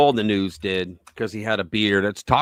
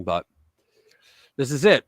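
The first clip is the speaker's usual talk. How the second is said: ordinary talk, nothing marked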